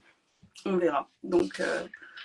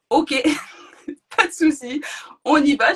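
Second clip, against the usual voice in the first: louder and higher, laughing through the words; laughing nervously; 5 semitones higher, 6 dB louder than usual. Real, not acted. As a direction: brace yourself, laughing. Waters break, nervous resolve.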